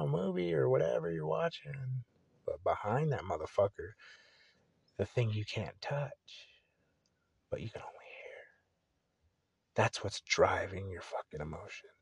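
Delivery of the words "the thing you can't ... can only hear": mysterious whisper. Real, not acted. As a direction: whisper it like a secret. Mysterious force behind films.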